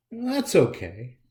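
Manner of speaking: usual speaking voice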